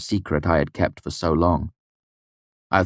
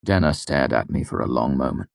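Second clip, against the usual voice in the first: ordinary delivery, nothing marked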